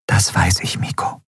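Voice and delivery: whispery, softening voice; hushed reply. A narrator's reading. Endriel answering Miko near the guards, hushed. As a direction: answer gently under cover.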